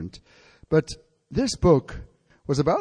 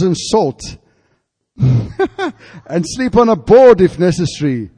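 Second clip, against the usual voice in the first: loud, rising preacher's vow; fervent preaching shout; 3 semitones higher, 9 dB louder than usual. Retold or imitated. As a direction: proclaim it with growing fire. Preacher acts out John's vow.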